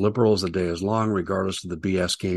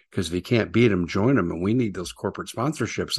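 ordinary talk

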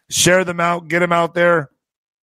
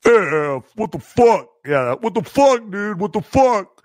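drawn-out, raised-pitch buddy impression; Scott; 4 semitones higher, slower than usual. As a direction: stretch it out like a stunned friend. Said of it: "how did Scott used to talk?".

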